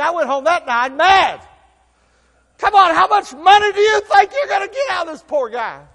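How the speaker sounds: high, loud angry outburst; furious retold protest; mad rant. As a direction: rant like you were cheated. Angry preacher recalls being mad.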